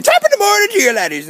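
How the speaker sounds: high, loud Irish greeting; Jacksepticeye. Shown as a demonstration. Showing off his Irish accent with a catchphrase, high exuberant Irish brogue. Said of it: "I just have to do the Jacksepticeye".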